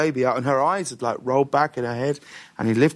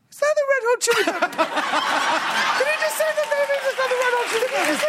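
much higher, fast, laughing excited woman's voice; faster than usual. Retold or imitated. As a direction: squeal it like a starstruck neighbour catching the band. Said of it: "the house of the woman going".

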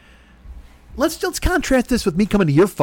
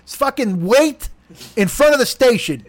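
normal talking voice

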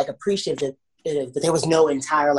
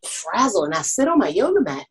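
ordinary talk, nothing marked

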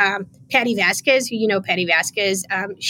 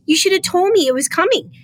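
higher, soothing voice imitating the mayor; higher, mock-gentle imitation of the mayor consoling; 7 semitones higher than usual. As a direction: pat the arm and play it sweet. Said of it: "grabbed my arm nicely, like, to console me".

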